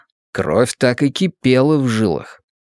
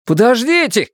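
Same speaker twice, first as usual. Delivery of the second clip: shouting; 10 semitones higher than usual. Acted. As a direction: call out after the others. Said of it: "крикнул Кори".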